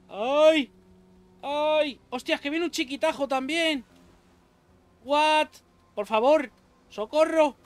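high, loud yelps for help; mock panic. Real, not acted. Gamer yelping for help as a little enemy charges. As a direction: squeal as the enemy rushes in.

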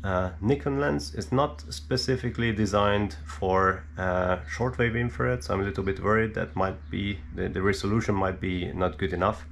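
ordinary talk, nothing marked

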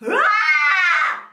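loud, high scratchy ah; a gritty fry sound; vocal fry scratch. Shown as a demonstration. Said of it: "like that".

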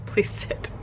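unvoiced whisper; whispering along. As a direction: mouth the line softly.

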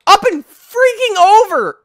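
very high, loud, drawn-out yell; exasperated shout. Real, not acted. Player failing the jump, shouting in exasperation. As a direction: yell it slowly in total frustration.

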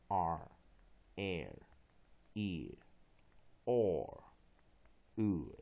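R, air, ear, or, ur drawn out one by one; American r-diphthongs. Shown as a demonstration. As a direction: model each r-coloured vowel slowly: R, air, ear, or, ur. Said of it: "美式双元音".

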